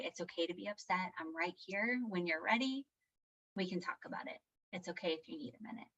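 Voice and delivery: hushed, patient parent voice; soothing. Retold or imitated. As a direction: comfort an upset child gently. Soothing an upset son.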